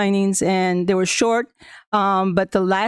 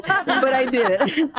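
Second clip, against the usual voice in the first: slow, high, laughing "but I did it"; 5 semitones higher, slower than usual. Real, not acted. Admitting she might never zip line again, laughing. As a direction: laugh it out, proud. Proud laugh after the jump.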